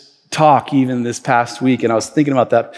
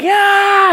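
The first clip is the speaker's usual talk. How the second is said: put-on jubilant kid yell. Son launching over his sister into the pool, a high joyful yell. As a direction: let out a toddler's flying shout of glee. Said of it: "he just was like".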